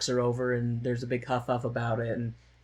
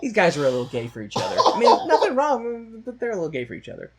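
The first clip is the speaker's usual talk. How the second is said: laughing; 9 semitones higher, 8 dB louder than usual. Real, not acted. Laughing through joke.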